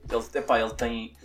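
ordinary delivery

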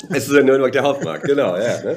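amused, laughing agreement. Real, not acted. Podcast guest agreeing with co-host's Nuremberg detail, laughing.

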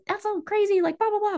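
high, dismissive inner voice; mocking skeptical mind. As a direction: voice the doubting mind as silly and high. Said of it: "your mind is like".

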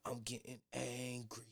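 low, soft slow mock toddler threat; imitating toddler. Father imitating his toddler daughter's threat, soft and slow. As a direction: play the little girl's slow threat. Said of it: "She was like".

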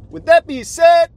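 high loud showman line; hype catchphrase. Acted. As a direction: belt it like a show host. Hyped transition catchphrase.

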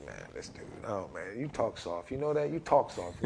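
low, hushed grumble at the counselor; mock mutter. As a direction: grumble it under your breath, low and quiet. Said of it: "I just sit there like".

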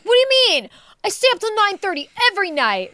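put-on high, loud indignant kid; upset protest. Mom imitates son told to go to bed, upset protest. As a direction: imitate a boy outraged at his bedtime. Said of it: "He got so upset".

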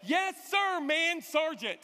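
high, loud group-style military shout; hammed-up rousing army response; whole bus responding. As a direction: imitate recruits sounding off together. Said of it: "the entire bus says".

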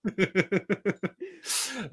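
long, high run of giggles; high giggling laugh. Real, not acted. Host still tickled after interrupting the guest, giggling. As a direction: keep giggling, pitched high.

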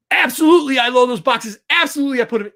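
retold yelling. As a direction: replay the worker yelling back, winding down. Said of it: "she starts yelling at me".

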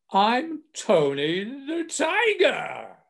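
high, very slow, drawn-out cartoon tiger boom; slow booming mascot voice; booming Tony the Tiger. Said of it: "in this booming voice".

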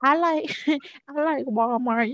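dog voice. Retold or imitated. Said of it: "that's what they be like".

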